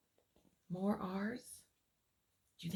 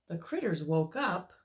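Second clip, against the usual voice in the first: story narration; 5 semitones lower than usual. A narrator's reading. Reads picture book aloud.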